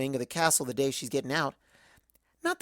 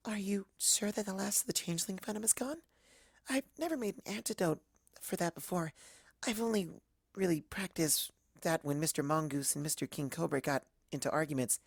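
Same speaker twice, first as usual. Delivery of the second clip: much softer, timid murmur; barely above a breath, shy and worried; timid Fluttershy; 9 dB quieter than usual. Acted. Fluttershy asks if the venom is gone, timid and much softer. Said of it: "says Fluttershy".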